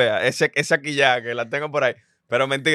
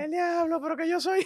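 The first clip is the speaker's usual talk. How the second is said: very high squeaky falsetto; odd-talking guy impression; 5 dB quieter than usual. Cohost joins the bit mimicking the guy, high squeaky falsetto. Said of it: "una gente que habla así".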